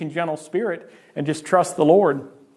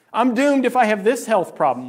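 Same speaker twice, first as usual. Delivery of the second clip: louder, higher mock panic; mock alarm; 5 semitones higher, 4 dB louder than usual. Preacher voicing a worrier facing a health problem, mock alarm. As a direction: overstate the doom, a notch louder. Voicing an anxious believer's thought.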